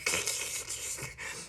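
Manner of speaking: voiceless mouth-made static; long unvoiced static noise. Shown as a demonstration.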